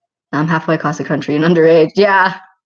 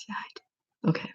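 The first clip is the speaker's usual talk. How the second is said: whisper; 12 dB quieter than usual. Real, not acted. Sheepish admission to a viewer.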